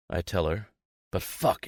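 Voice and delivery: plain reading voice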